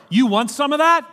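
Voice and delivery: loud, high, laughing New York "You want some of that?"; New York challenge. Pretend. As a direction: throw the New York line at the crowd. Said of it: "New York way of saying it".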